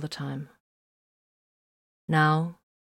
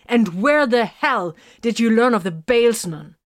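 higher and louder, accusing; heated interrogation of a friend; angry; 6 semitones higher, 6 dB louder than usual. A narrator's reading. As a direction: demand an answer, furious. Vox furious at his friend.

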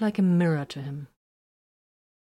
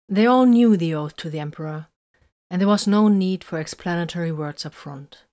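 ordinary delivery

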